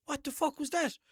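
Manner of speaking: high-pitched indignant question; startled challenge. Retold or imitated. Driver to guard after hearing the bang, startled challenge. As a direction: retell a startled "what was that?".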